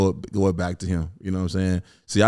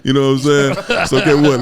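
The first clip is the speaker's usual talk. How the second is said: louder, higher boastful rant; hyped bragging; 5 semitones higher, 8 dB louder than usual. Real, not acted. Asked about Memphis greats, loud hyped bragging. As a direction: hype yourself up, laughing.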